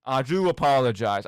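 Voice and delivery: usual speaking voice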